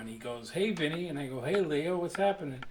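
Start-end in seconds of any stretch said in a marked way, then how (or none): 0.5-1.1 louder, higher imitation of a friendly hail; retold greeting call. Greeting called to a passerby. 1.4-2.6 loud, higher re-enacted hello; retold greeting call. Calling back a greeting.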